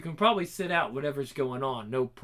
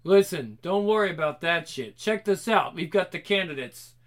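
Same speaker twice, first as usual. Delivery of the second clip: ordinary delivery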